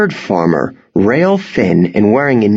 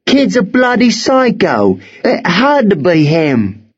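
high, excited accuser's voice; accusing youth; 11 semitones higher than usual. Acted. Worm, suddenly hopeful, blames the boy, accusing. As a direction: pin the blame eagerly, pitched well up.

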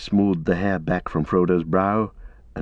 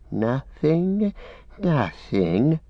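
high, drawn-out creature voice, innocent denial; soft, singsong creature wheedle; soft Gollum; 6 semitones higher, much slower than usual. A narrator's reading. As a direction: play the wheedling creature, softly protesting. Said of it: "said Gollum softly".

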